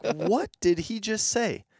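high, slow, stunned question; retold disbelief. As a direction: stretch it out high, baffled. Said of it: "I'm like".

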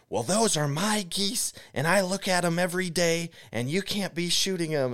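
high, insistent mock outrage; imitated woman losing it. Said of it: "she's losing her mind".

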